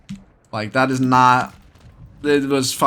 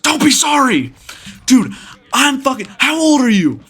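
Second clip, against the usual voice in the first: high, mock-furious snap; retold angry retort; 11 semitones higher, 3 dB louder than usual. Angry man rejecting the storyteller's apology, high-pitched anger. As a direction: imitate an irate man cutting off an apology.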